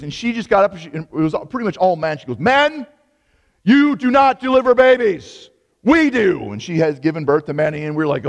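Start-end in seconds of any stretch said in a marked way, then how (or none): 2.4-6.5 high, loud, slow proclamation; retold emphatic declaration. ER nurse setting the men straight, emphatic. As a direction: lay down the law in a ringing voice. Nurse firmly lectures the male trainees.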